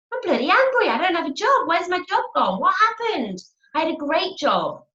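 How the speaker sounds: high, indignant mock rant; demo anger. Shown as a demonstration. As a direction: rant like someone just made redundant. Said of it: "Anger's better than depressed".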